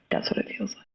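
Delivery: breathy, almost voiceless trailing off; hushed and spent after opening up; near whisper. Real, not acted. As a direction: let the words fall away to a whisper. Client trailing off after disclosure.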